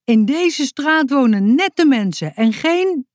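high, shrill complaining neighbour; indignant shrill storybook neighbour; shrill woman shouting. A narrator's reading. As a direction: call out in a high, piercing woman's voice. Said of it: "riep een vrouw met een schelle stem".

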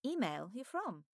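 quietly surprised; Alice. Acted. Alice voiced in dialogue.

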